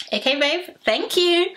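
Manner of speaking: sassy. Real, not acted. Sassy dismissive sign-off.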